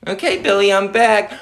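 ordinary talk, nothing marked

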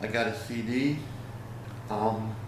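normal talking voice

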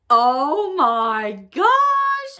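very drawn-out, rising awe; dramatic reveal. Pretend. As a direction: stretch every word for a big reveal. Big reveal of the giant item.

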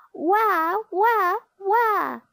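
high, loud storybook "wah" crying; mock baby wail. A narrator's reading. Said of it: "she cried".

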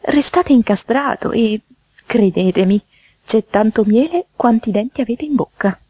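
high-pitched urgent mouse; fussy, alarmed hostess voice; alarmed warning. Acted. Mouse warns the toad off.

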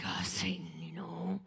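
low whispered taunt; hushed low taunt; mock whisper to Satan. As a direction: breathe it at Satan, mocking. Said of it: "I was like".

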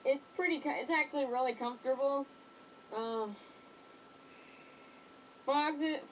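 muffled behind rubber mask; speaking into mask filter; through a gas mask. Real, not acted. Seen 0: Gas mask covers the whole face; speaker's mouth is hidden throughout.

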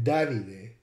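Davide as "DAH-vee-deh"; Italian Davide. Shown as a demonstration. Lecturer showing Italian, Davide as DAH-vee-deh. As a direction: say Davide in three syllables, Italian style. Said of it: "in Italian".

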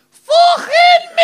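very high, long-drawn Liverpool shriek; heckler's shout. Retold or imitated. Liverpool audience member answering comedian from the back, shrieked. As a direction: bellow it high from the back, stretching the last word. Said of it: "a voice at the back went".

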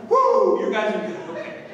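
very high, loud whoop into a laughing line; relieved whoop. Retold or imitated. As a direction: let the relief burst out with a laugh. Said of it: "relieved, like, this breath of fresh".

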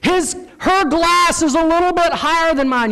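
put-on high-pitched kid complaint; whining little kid. Little kids keeping score, whiny high voice. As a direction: imitate a child protesting unfairness, way up high. Small kids comparing glasses.

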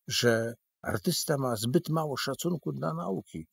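ordinary delivery, nothing marked

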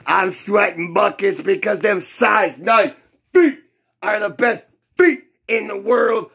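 normal talking voice

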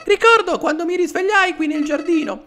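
very high falsetto, recounting a frightening memory; squeaky little flower telling its sad story; Flowey. Acted. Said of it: "la voce sarà difficile da fare". (vocina acutissima che racconta un brutto ricordo)

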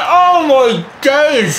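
high, drawn-out shout; long euphoric cry; ecstatic disbelief. Real, not acted. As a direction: stretch it out in triumphant amazement. Celebrating winning goal.

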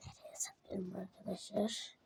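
soft whisper to viewers. Real, not acted. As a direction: whisper it secretly.

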